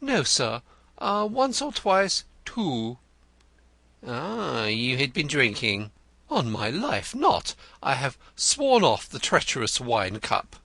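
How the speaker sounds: Denzil the poet. A narrator's reading. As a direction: play him florid and eager. Denzil answers Wimp.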